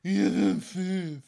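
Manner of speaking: slow, loud, put-on officer voice; exaggerated pompous declaration; authoritative voice. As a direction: drag it out with mock gravity. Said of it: "with a very authoritative voice".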